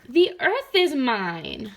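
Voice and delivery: slow, stubborn frog voice; possessive frog. Acted. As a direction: draw out the claim greedily. Quarrelling frog claims earth.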